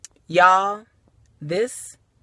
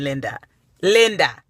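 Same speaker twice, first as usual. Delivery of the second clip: ordinary delivery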